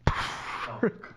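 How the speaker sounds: long breath held, then let out; a heavy exhale after a long gap; laboured dying breath. Retold or imitated. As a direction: imitate slowing deathbed breathing. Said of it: "the intervals are getting longer".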